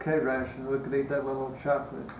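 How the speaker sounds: ordinary delivery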